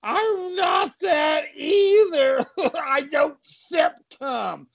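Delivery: high, tearful cartoon cop; high-pitched crybaby policeman; wailing cop. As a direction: blubber in protest, drawn out. Cop wails back at insult.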